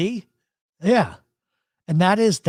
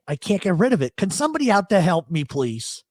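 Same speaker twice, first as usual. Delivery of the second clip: ordinary talk, nothing marked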